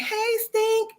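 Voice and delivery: high, bright sing-song hello; friends' phone greeting. Retold or imitated. Friends answering her early call, high sing-song greeting. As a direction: chirp the greeting the way they answer. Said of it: "they answer the phone like".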